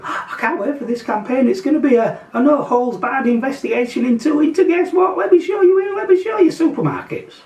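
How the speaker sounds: mock excitement. Vlogger mocking the cycling campaign's big reveal, with hammed-up excitement. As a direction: fake thrill building to a yell.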